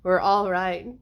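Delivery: put-on American accent; lightly imitated American accent; blend-in American accent. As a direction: try to pass as American. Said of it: "I'm trying to blend in".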